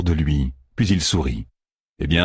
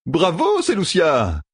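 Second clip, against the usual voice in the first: high, mock-admiring cheer; crying out; 9 semitones higher, slower than usual. A narrator's reading. Matt applauding Seleucia's remark, crying out. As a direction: call out an exaggerated bravo. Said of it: "s'écria Matt".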